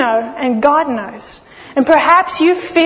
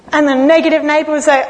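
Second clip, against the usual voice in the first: ordinary delivery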